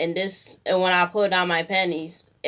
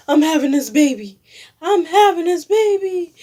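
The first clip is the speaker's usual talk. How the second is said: high, loud, near tears; clinging to hope, voice rising and breaking; desperate insistence; 10 semitones higher, 6 dB louder than usual. Retold or imitated. Insisting on keeping the baby.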